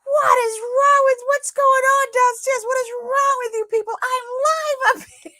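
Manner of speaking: very high, loud exasperated scream; reenacted screaming. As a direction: scream downstairs at the noisy kids. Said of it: "all of a sudden you hear me scream".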